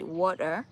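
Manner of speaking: "water" with an R at the end; American pronunciation. Shown as a demonstration. Showing Kenyans the optional American "water", R-ended. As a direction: let "water" rise onto a final R. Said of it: "You don't have to say it".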